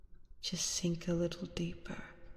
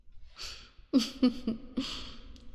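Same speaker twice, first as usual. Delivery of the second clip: hushed, breathy villain giggle; 6 semitones higher than usual. Acted. Vampire delighting in the entranced hunter, hushed evil laugh. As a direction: chuckle under the breath in triumph.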